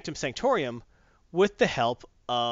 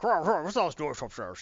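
lightly voiced gruff ghost dog growling; a hint of a grumbling old guard dog; grumpy ghost dog. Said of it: "He's like".

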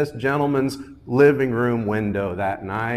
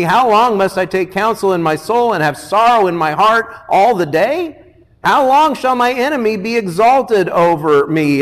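raised, louder preaching cry; impassioned psalm plea; 6 semitones higher, 7 dB louder than usual. Acted. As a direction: plead the psalm out to God.

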